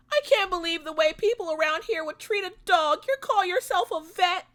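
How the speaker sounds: high, shrill-sounding outrage at the vet; mock indignant lady. Dog owner insulting the vet, high and outraged. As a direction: sneer it like an offended diva.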